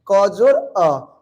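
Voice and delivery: क जोड़ अ को धीरे तोड़कर बोलना; शब्दों को अलग अलग धीरे कहना; धीमा जोड़. Shown as a demonstration. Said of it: "देखना". (धीमी व्याख्या वाली आवाज़)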